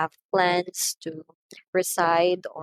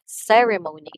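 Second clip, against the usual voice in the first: CEremony, front syllable hit louder; stress on first syllable; 5 dB louder than usual. Shown as a demonstration. Teacher reminds learners of the word, "Ceremony" stressed on first syllable. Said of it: "the first syllable receives the stress".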